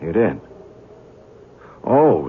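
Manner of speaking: ordinary delivery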